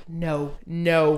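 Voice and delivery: low, muted refusal; quiet, sinking denial; inner dread. Retold or imitated. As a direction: say it low, as if to yourself. Dread in her head.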